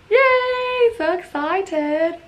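high bright yay, then slow; excited cheer. Real, not acted. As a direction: cheer with real delight. Brownies came out well.

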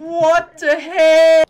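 stretched-out shocked cry; shocked yell. Real, not acted. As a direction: yell it in disbelief. Shocked reaction in game.

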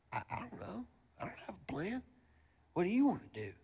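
high, hushed, unsure voice; hesitant, stumped friend. Acted. One friend admitting to the others he has no plan, stumped. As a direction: play the clueless buddy in a soft high voice.

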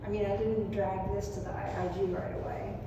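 normal talking voice

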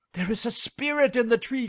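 high, fast, alarmed woodcutters; frightened cry. A narrator's reading. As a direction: cry out in fright, rushed and loud. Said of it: "cried the woodcutters, thoroughly frightened".

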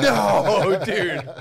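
high, loud, laughing no; hammed-up horrified objection; mock outraged refusal. Friends refusing the plot, loud high mock outrage.

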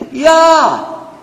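high, loud Arabic hail; calling out. Shown as a demonstration. Preacher showing how to call somebody in Arabic, calling out. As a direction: holler it across to someone. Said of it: "How do you do that in Arabic?".

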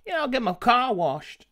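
put-on Texan twang; Texan accent. Game master playing along as a local, Texan accent. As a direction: talk like a Texas local. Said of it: "You're from Texas".

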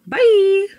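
high, bright call of bye; high, loud parting call; cheery goodbye. Real, not acted. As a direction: sing out a goodbye to the camera. Leaving for work, calling out.

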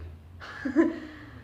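airy rising laugh; a short helpless laugh; real helpless chuckle; breathy laugh. Real, not acted. Laughing at his own joke.

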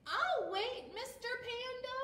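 eager high little penguin. Acted. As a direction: voice the patient penguin up high and bright. Penguin voice again.

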